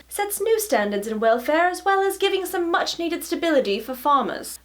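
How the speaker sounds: high-pitched, put-on posh British reading; posh British accent. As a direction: read it aloud as an upper-class British writer. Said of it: "my poshest British accent".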